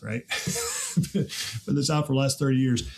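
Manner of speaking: ordinary talk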